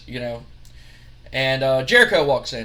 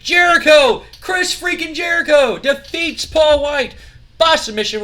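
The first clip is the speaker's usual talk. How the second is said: loud, high-pitched match result call; excited ring announcer; 10 semitones higher, 9 dB louder, slower than usual. Acted. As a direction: proclaim the winner like a wrestling announcer.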